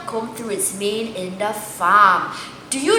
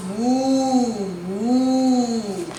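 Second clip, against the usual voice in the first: slow drawn-out moo; soft storybook moo; cow moo; 5 dB quieter than usual. Shown as a demonstration. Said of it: "The cow goes".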